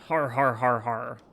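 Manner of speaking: hearty mock pirate "har har"; pirate laugh.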